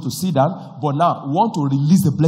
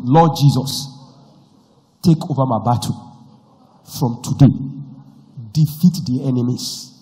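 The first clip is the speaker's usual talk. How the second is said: leading a prayer; 3 semitones lower than usual. Acted. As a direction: pray it aloud for them to echo.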